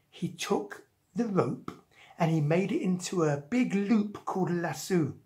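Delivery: ordinary delivery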